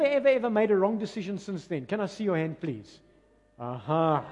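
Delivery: ordinary delivery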